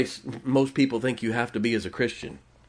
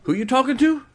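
higher, sharp challenge, half joking; mock stern challenge; 11 semitones higher than usual. Preacher confronting a strong-willed lady, mock stern. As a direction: put her in her place, playfully. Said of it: "look at one of these strong ladies and say".

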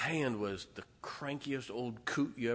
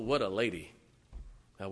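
ordinary talk

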